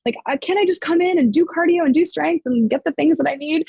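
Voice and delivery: high, exasperated complaining; mock-whine like a fed-up client; retold high-pitched gripe about the extra drill; annoyed client. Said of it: "my clients would get annoyed".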